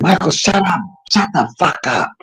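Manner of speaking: high, mock-stern mother voice; mama's voice. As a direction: playfully imitate mom telling him off. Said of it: "she said".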